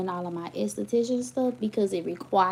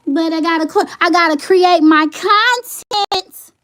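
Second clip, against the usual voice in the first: emphatic declaration; 10 semitones higher, 10 dB louder than usual. Real, not acted. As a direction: proclaim it like a personal mission. Insists on making content.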